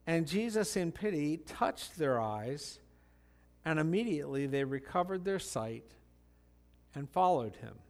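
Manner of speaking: measured Bible reading voice; scripture reading. Acted. Pastor reads Matthew aloud, measured. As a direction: read the passage to the congregation.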